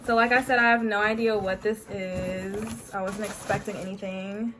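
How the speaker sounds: ordinary delivery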